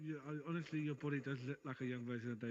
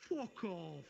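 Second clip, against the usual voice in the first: higher, lightly put-on British accent; 4 semitones higher than usual. Shown as a demonstration. Showing a friend how to play up [name], British accent. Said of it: "Give it a British accent as well".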